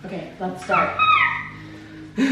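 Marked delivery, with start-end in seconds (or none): 1.0-2.1 excited squeal. Real, not acted. Results appear.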